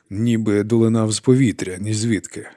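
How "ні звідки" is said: usual speaking voice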